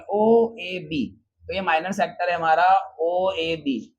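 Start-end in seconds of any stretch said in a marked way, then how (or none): 0.0-1.2 each letter drawn out very slowly; name the points as you write them; letters stretched while writing on the board; spelled out slowly. Shown as a demonstration. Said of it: "इस sector का नाम लिखता हूँ".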